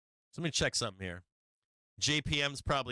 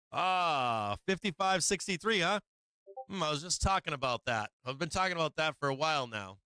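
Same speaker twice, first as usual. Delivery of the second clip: ordinary talk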